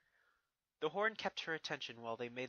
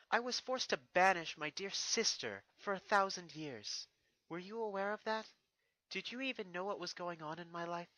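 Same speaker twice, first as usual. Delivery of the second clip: put-on higher, stately princess addressing her old enemy; regal princess voice; 6 semitones higher, 4 dB louder than usual. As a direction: speak down to a defeated foe, proud and composed. Narrator voices Celestia.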